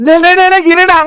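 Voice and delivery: loud, high repeated calls of டேய்; shouting after a friend on the phone; urgent calling out. Acted. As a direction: call him back before he hangs up.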